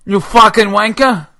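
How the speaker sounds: loud, angry cockney yelling; mock British shout; British accent. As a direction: rant like an outraged London bloke. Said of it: "the British guy".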